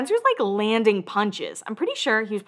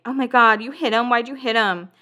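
higher-pitched, lightly acted disbelief; mock shocked questioning; 3 semitones higher than usual. Acts out Heidi confronting Spencer.

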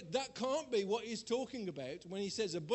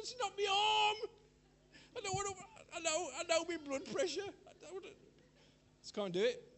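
high, half-crying plea; tearful protest; 11 semitones higher than usual. Retold or imitated. Preacher replays his frustrated plea to the nurse, high and tearful. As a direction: cry out that it is not the arm. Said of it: "She said".

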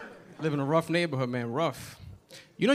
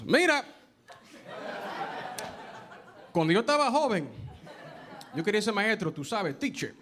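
higher-pitched Dominican-style Spanish-speaking dad impression; dad's Spanish accent; 5 semitones higher than usual. Dad telling son his childhood dream, thick Spanish accent. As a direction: speak Spanish as the dad, last word in heavily accented English. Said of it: "that's how he said it".

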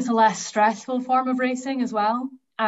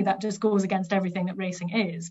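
ordinary talk, nothing marked

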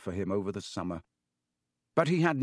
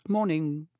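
high, prim and stiff; slightly stiff greeting; 6 semitones higher than usual. A narrator's reading. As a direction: greet them with slight disapproval. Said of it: "slight stiffness in the way she said".

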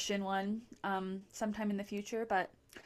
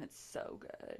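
whispered, low, blissful; savoring delicious food; 10 dB quieter, slower than usual. Real, not acted. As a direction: breathe it out like a perfect bite. Raving about glazed sprouts.

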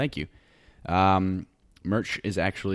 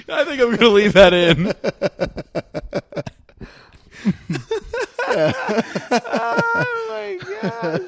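real helpless high-pitched laughing fit; loud, high, can barely talk for laughing; sobbing with laughter; 13 semitones higher, 9 dB louder, slower than usual. Real, not acted. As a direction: squeal the words out through tears of laughter. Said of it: "We were both sobbing".

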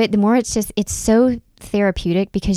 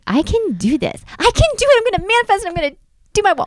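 high-pitched, gleeful self-hyping, speeding up at the end; mock pep talk; 14 semitones higher, faster than usual. Hyping herself up to build her wall, mock gleeful. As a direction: squeal it like a giddy pep talk.

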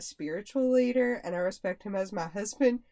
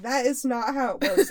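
retold indignant protest; 4 semitones higher, 8 dB louder than usual. Mock argument with husband.

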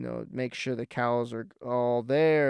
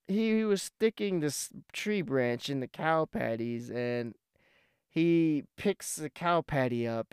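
usual speaking voice

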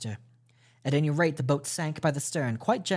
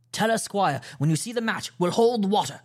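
higher, louder command; urgent order; 7 semitones higher, 4 dB louder than usual. A narrator's reading. Captain ordering the squire and rowers, raised urgent. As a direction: call it out across the boat.

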